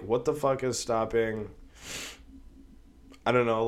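ordinary talk, nothing marked

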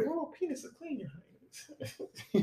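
high, soft, laughing proclamation; mock proclamation. Mock royal proclamation echoed.

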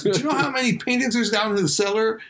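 guard, high and exasperated. Retold or imitated. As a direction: rant like the fed-up guard. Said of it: "So that's".

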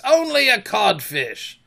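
slow, high, loud jibe; drawn-out mocking voice; taunting. A narrator's reading. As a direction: rub in the insult. Taunting Hook with an insult.

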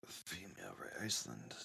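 whispered under breath; muttered reading. Real, not acted. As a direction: whisper it to yourself. Reading quietly to himself.

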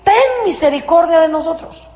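a high mock cry for mercy; pleading cry. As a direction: call out to Jesus over the crowd. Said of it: "Y le gritaron".

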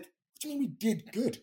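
high, loud What do you mean we did good?; incredulous retort. Retold or imitated. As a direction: throw back the words in disbelief.

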